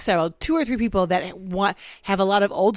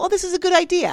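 mock eager approval; 10 semitones higher, faster than usual. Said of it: "I go".

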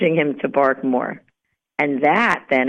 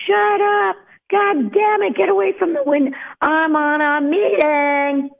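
screaming at the dog; 9 semitones higher than usual. Acted. Owner on a Zoom call yelling at the barking dog, screaming. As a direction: yell like a fed-up owner losing it. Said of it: "Why are we screaming at the dog".

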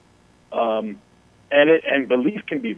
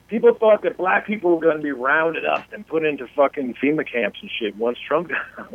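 normal talking voice

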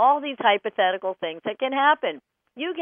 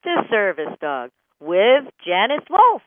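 slow, loud welcome-back announcement; show host welcome; 9 dB louder, slower than usual. Acted. A radio host greeting listeners after the break, in a slow, loud announcer voice. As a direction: announce the show's return.

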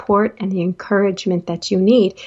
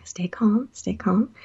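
whisper; 6 dB quieter than usual. Retold or imitated. Telling herself to calm down.

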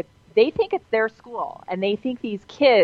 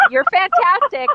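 excited; 13 semitones higher, 5 dB louder than usual. Real, not acted. Host praising caller.

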